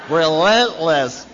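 drawn-out loud "Relentless" through laughter; slow, loud, laughing "Relentless"; laughing emphasis. Real, not acted. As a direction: stretch "Relentless" slowly, laughing as you say it. Laughing repeat of the word.

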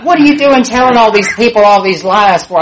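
loud, high-pitched accusation; acted indignation. Drunk roommate confronting her over lies on the phone, loud indignation. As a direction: play an outraged roommate calling out lies.

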